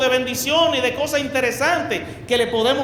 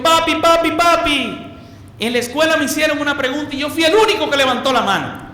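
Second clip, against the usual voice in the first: put-on eager little kid calling papi, higher and louder; excited child; 5 semitones higher, 4 dB louder than usual. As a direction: play the child bursting to tell dad. Hijo emocionado le cuenta al papá. (hijo emocionado que le cuenta al papá, más agudo y fuerte)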